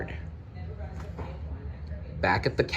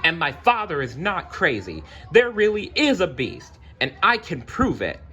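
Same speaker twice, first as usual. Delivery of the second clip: defiant; 9 semitones higher than usual. Acted. Belle defiant to crowd.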